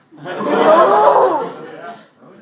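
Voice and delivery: loud high burst of laughter; big high laugh. Real, not acted. Speaker laughing at his own scholarship pitch, a loud high laugh. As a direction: crack up at your own joke.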